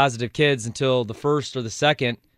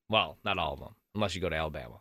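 sarcastic; 9 semitones lower, 11 dB quieter, faster than usual. Real, not acted. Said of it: "Also sarcasm".

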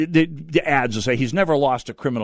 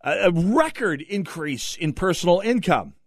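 ordinary talk, nothing marked

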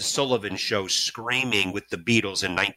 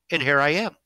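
ordinary talk, nothing marked

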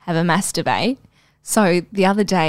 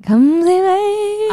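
high, slow, drawn-out mocking singsong; mock echo; 12 semitones higher, 5 dB louder, much slower than usual. Teasing her friend by echoing back, high drawn-out singsong. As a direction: stretch the words out high to tease.

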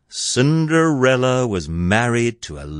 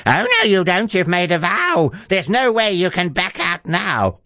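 high, scolding character voice; high-pitched bossy protest; insistent ugly sister; 10 semitones higher than usual. A narrator's reading. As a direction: press the objection in a high screech.

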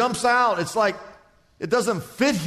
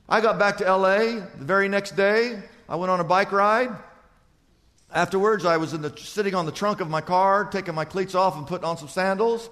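ordinary talk, nothing marked

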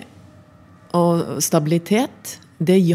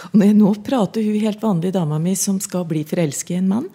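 normal talking voice

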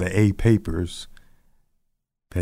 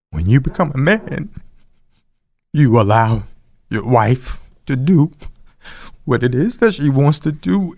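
grandmother's words; 5 semitones higher than usual. Retold or imitated. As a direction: speak as the wise grandmother laying down the rule. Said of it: "And she said".

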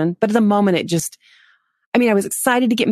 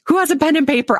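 high, excited call for help; acted-out comic eagerness; eager mock urgency; 8 semitones higher than usual. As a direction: blurt it out, pitched well up. Acts out her eager reaction.

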